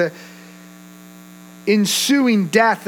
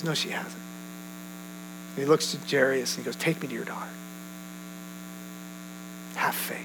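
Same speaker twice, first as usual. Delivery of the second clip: quiet assurance; 6 semitones lower, 13 dB quieter, faster than usual. Acted. Jesus calmly overrides news of death.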